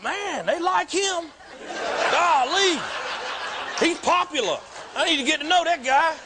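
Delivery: hammed-up gee-whiz wonder; amazed naive newcomer. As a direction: gush over how popular he is. Inner thought, amazed newcomer.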